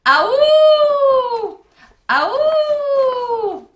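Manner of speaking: very loud, high, long howl; hideous howl. A narrator's reading. The Howler answers the campers, hideous howl. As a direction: howl like a monster in the woods. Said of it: "A howl so loud, so hideous".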